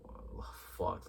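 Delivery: whispered curse, almost no voice. Retold or imitated. Scared agent hearing something in the dark, whispering. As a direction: breathe it out like you fear being heard.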